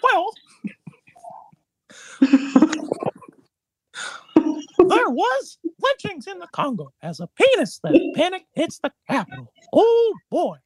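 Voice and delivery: high squeaky cartoon mouse voice; Steamboat Willie voice. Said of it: "in the Steamboat Willie voice".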